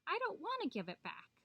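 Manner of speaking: high-pitched, stubborn protest; protesting Coyote. A narrator's reading. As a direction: refuse in a high whine of complaint. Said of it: "cried Coyote".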